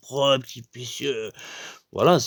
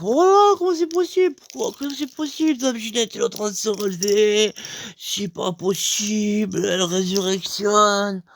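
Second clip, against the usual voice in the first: astonished crowd; 7 semitones higher than usual. Retold or imitated. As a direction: cry out that it can't be possible. (foule stupéfaite, voix très aiguë et forte)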